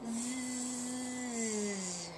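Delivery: a quiet, drowsy little snore; soft snore. A narrator's reading. The big dictionary dozing off on its shelf, soft snore. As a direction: snore softly like a sleeping book. Dictionary falls asleep at night.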